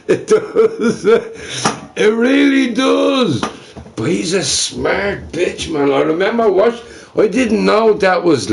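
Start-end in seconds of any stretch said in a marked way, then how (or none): 0.0-3.4 high, slow words through laughter; laughing. Real, not acted. Streamer admitting he hates smart people, laughing.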